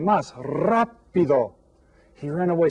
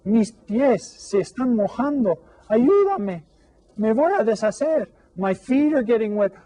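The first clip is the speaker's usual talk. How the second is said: frightened plea; 9 semitones higher than usual. A narrator's reading. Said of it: "el hombrecito de pan jengibre dijo".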